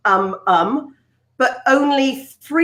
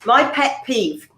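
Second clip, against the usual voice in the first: TV presenter tic; slower than usual. Retold or imitated. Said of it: "people on TV do".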